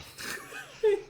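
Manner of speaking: a high breathy burst of laughter; real laugh cracking up. Real, not acted. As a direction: laugh out at the table. GM laughs at player.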